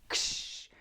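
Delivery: hissed, drawn-out "ksh"; imitated firework whoosh. Describing the wheel flying into the neighbour's shed, hissed imitated whoosh.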